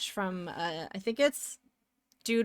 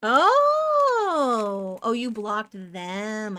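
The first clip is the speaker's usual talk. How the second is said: dawning realisation; 6 dB louder, much slower than usual. Real, not acted. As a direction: suddenly get it, stretching the "Oh". Sudden realisation of the misunderstanding.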